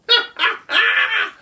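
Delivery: high shrieking bird cackle; kookaburra cackle. Acted. Kookaburra laughing at the kangaroo, high cackle. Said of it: "laughed Colin".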